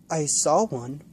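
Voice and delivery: ordinary delivery, nothing marked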